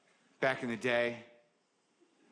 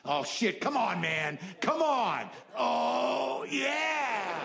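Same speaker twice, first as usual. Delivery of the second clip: frustrated shout; 10 semitones higher, much slower than usual. Real, not acted. Frustrated outburst at a memory.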